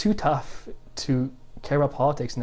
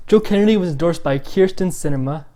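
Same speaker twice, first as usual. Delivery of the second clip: usual speaking voice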